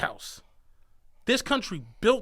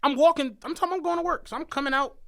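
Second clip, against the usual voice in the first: ordinary talk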